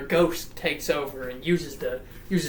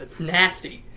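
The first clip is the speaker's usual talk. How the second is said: ordinary talk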